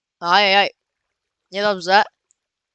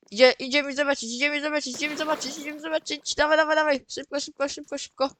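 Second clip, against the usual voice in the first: soft, high, hurried repetition; quiet, higher-pitched eager chanting; hushed urgency; 5 semitones higher, 6 dB quieter, faster than usual. Real, not acted. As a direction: urge yourself along under your breath. Hurrying to check the shot.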